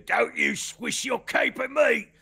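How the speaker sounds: high, loud angry goblin shout; big goblin yelling at the swordswoman. Acted. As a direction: bellow at the swishing cape. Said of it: "one did that thing of".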